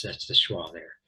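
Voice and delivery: normal talking voice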